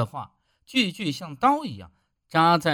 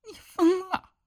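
惊怒地尖声叫出来，音调猛地拔高; 被扔了被子，又惊又气地喊. A narrator's reading. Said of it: "细桃光着身子叫道". (细桃冲着丈夫尖声叫喊)

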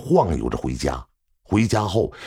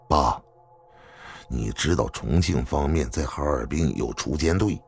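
low, softer, earnest warning; grave, concerned son; 6 semitones lower, 5 dB quieter than usual. A narrator's reading. Son warning his father of assassins, grave. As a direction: warn him quietly and steadily.

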